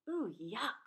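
ordinary delivery

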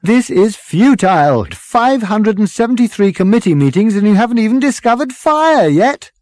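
loud, high-pitched exasperation, building; exasperated protest. Acted. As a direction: protest at the sheer futility. Ford protests at committee meeting.

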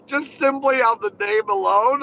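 high, loud words through laughter; laughing protest. Real, not acted. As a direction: protest while cracking up. Cracking up over the ride name.

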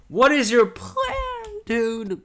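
slow, strained squeal that cracks; voice cracking. Real, not acted. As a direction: voice breaks as it shoots up into a yell. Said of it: "My voice is cracked".

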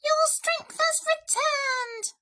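very high, slow squeaky mouse cry; exclaiming mouse. A narrator's reading. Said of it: "Mouse exclaimed".

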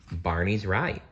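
ordinary delivery

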